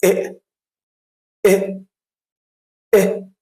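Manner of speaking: "إيه" as a quick, short "eh"; short E sound. Shown as a demonstration. Teacher answers what the first sound is, short quick "إيه". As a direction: say "إيه" briefly, no stretch on the vowel. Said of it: "بسرعة كده، مفيهاش ولا مد".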